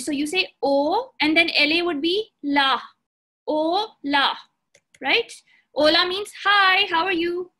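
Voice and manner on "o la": "O, la" in two separated beats; split into syllables. Shown as a demonstration. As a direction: teach it piece by piece: "O", pause, "la". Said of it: "break it down into two parts".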